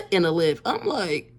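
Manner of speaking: loud, high, laughing through the words; real helpless amusement; cracking up. Real, not acted. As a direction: tell it as laughter takes over. Cracking up at own story.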